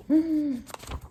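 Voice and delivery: a short real laugh at the story; amused chuckle. Real, not acted. Reader chuckling at the boy's bad start. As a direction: chuckle to yourself mid-reading.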